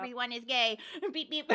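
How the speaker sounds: lightly voiced robot. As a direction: say it like a toy robot. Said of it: "That was my impression of you".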